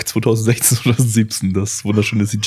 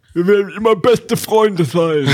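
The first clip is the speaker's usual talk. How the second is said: mock anime vow; 10 semitones higher than usual. Parodies anime friendship vow.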